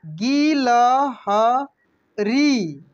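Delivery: गिलहरी drawn out very slowly, each syllable separate. Shown as a demonstration. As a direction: sound out गिलहरी slowly for learners. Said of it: "इसके बाद देखेंगे यहाँ पे". (गिलहरी को अक्षर अक्षर धीरे बोलिए)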